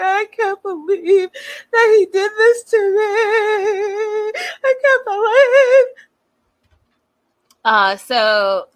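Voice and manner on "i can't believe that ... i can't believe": high-pitched theatrical wail; mock crying. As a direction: imitate a sobbing meltdown, dragging out the words. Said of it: "She starts crying again".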